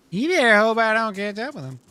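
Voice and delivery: the same threat redone as a high put-on old man; Uncle Tad voice. Uncle Tad threatening the man who ran off, high character voice. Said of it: "repeated in character".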